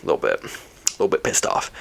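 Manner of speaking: whispering it under the breath; whispered aside. Shown as a demonstration. Vlogger admitting her annoyance, whispered aside. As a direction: hush it like a secret admission.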